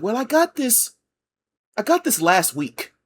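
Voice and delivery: high-pitched, mock insistent protest; insistent customer. Customer insisting to the barista about last week, mock indignant.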